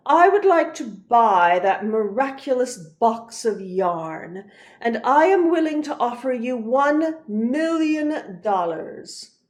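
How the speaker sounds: loud, grand nobleman; pompous archduke. Acted. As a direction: lord it over her with money. Archduke offers to buy yarn.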